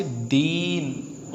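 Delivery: दीन with the ई stretched out; drawn-out ई in दीन, as a teacher's demonstration; long vowel. Shown as a demonstration. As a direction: hold the ई of दीन long and slow. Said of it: "बड़ी ए आई तो हमने इसे क्या बोला?".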